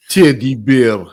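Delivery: low, loud, menacing Russian accent; mean Russian accent. Shown as a demonstration. Showing anything sounds mean, menacing Russian accent. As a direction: make it sound nasty in Russian-accented English. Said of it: "say anything in a Russian accent".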